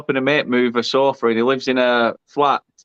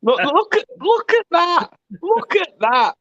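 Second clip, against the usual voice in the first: high, astonished look at that; shocked exclaim; 13 semitones higher than usual. Real, not acted. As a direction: point at it in disbelief. Shocked at the sunburn photo.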